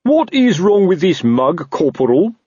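slow, high, indignant demand; shouting. A narrator's reading. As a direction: spell out the outrage to an underling. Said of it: "heard Colon shouting".

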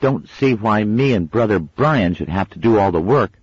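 put-on a kid sweaty and fed up; grumbling boy. A narrator's reading. Wensleydale complaining.

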